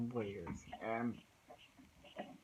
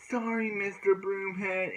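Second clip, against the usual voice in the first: higher, drawn-out retold apology; mock apology; 7 semitones higher, slower than usual. Hyuga to the boy he knocked into the water, mock apologetic. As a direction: toss out a slow, high mock sorry.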